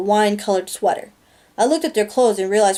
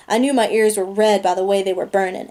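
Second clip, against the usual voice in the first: usual speaking voice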